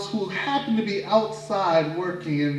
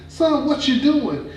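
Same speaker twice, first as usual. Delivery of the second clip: high mama voice; 10 semitones higher, 4 dB louder, slower than usual. Acted. Mama questions son again.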